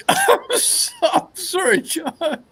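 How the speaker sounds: giggling through an apology; laughing. Real, not acted. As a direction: laugh while saying sorry. Cracking up at friend.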